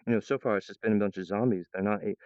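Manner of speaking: usual speaking voice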